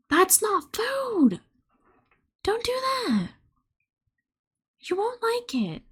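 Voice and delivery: very high, drawn-out squeal of reproach; pet-talk, way up high; high-pitched pet scolding. Real, not acted. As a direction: scold the kitty in a squeaky little voice. Scolding her cat in baby talk.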